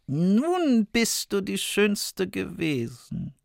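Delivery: high, slow, soft gloat; gloating queen. A narrator's reading. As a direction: sneer it triumphantly. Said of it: "sprach die Königin".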